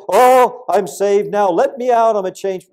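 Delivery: high, loud sarcastic mock plea; mocking a prisoner faking faith; mock convert. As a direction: sneer it like a fake jailhouse convert.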